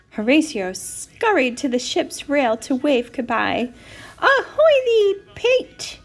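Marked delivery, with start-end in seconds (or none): 4.2-6.0 high, loud sailor's hail; long, ringing ahoy; calling out. A narrator's reading. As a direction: hail a departing friend across the water. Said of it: "he called".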